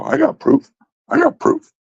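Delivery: high mocking voice for a bluffing liar. Streamer mocks a liar who never shows proof, high mocking voice. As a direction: squeak it out like a fake show-off. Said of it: "and you're like".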